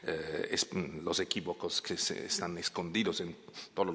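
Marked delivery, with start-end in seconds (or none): none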